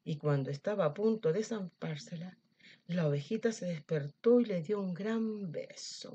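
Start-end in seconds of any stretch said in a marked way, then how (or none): none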